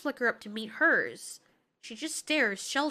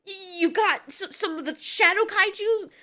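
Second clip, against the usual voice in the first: loud, higher-pitched scolding; agitated yelling; 5 semitones higher, 5 dB louder than usual. Acted. Mothra confronts Godzilla about killing humans, yelling. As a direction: yell it in stammering exasperation. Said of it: "Can you kindly stop yelling?".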